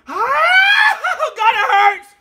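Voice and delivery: laughing pained shout. Real, not acted. Vlogger signing off with a burning mouth, laughing pained shout. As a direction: shriek it out, half laughing at the burn. Pain during the outro.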